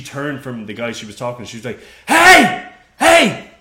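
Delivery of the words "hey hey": very high, loud mock shouting; shrill-pitched impression of a server shouting across the bar; imitated angry yelling. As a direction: yell like you're scolding a dog. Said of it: "she yelling at a fucking dog".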